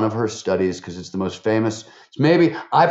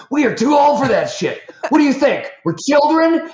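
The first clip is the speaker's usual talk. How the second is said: much higher, louder mock scream, laughing; angry scream; 5 dB louder than usual. Retelling the girlfriend yelling at them, in a laughing mock scream. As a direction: shriek it like a furious girlfriend, laughing through it. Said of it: "like, screams it. She's so mad".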